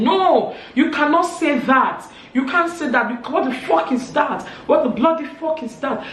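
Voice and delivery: high, loud outraged yelling; shouting. Retold or imitated. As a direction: shout it down in a furious retold row. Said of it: "Teresa was just shouting".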